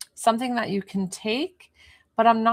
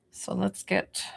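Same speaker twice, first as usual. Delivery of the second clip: whispering; 6 dB quieter than usual. Real, not acted. Healer speaking during session.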